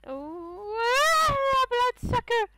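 high, loud startled scream; being chased. Real, not acted. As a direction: shriek at the monster in fright. Bloodsucker attacks in game.

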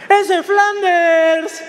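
loud, high, drawn-out call; full-voiced slow holler; retold shout. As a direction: yell it from afar, stretching each syllable. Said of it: "me gritan".

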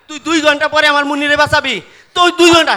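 fast, loud, indignant; outraged. Acted. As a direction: fire it back in disbelief. Father outraged at two hours. (রেগে দ্রুত জোরে বলা)

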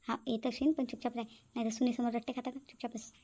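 soft urgent hush so father won't hear; whisper-hush her to keep quiet; hushed warning. Acted. Son hushing his mother in front of father, hushed.

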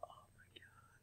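breathy whispered disbelief; whispered oh my God. Real, not acted. Vlogger to viewers after the sugar, whispered dread. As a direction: whisper it, overwhelmed.

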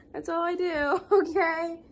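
laughing. Real, not acted. Admitting to viewers a habit of floor-lying, laughing. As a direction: laugh through the words.

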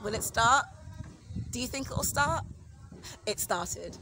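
ordinary delivery

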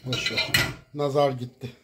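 ordinary talk

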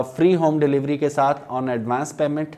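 ordinary talk

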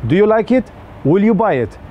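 ordinary talk, nothing marked

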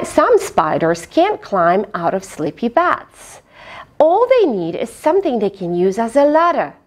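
ordinary delivery

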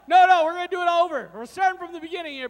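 loud and fast, fired up; rally the crowd to go again; excited fast restart. Real, not acted.

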